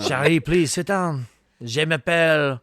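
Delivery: hammed-up French accent, playing the robot car. Friend mocks the movie robot in exaggerated French accent. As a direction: lay on a thick mock French accent. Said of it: "French accent".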